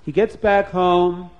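ordinary delivery

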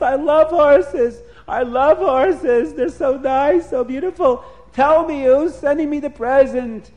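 high, loud delight; excited king. A narrator's reading. King learns the horses are his, thrilled. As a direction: exclaim it with drawn-out, gleeful emphasis. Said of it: "the king was so excited about the presents".

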